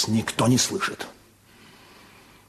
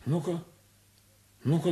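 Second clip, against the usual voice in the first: ordinary talk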